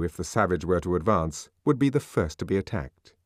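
usual speaking voice

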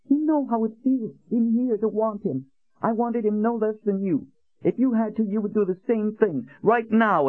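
put-on high, indignant young woman; angry Nadine. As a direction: fire it back at her, high and hurt. Nadine shouting back at Colin.

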